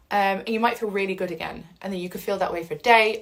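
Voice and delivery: ordinary delivery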